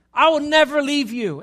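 loud, high-pitched pledge; insistent Peter. Acted. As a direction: swear loyalty at full voice. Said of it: "And that's what he says".

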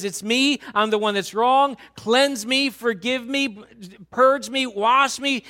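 David to God, fervent pleading confession. Acted. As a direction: cry out for cleansing. David's confession voiced loud.

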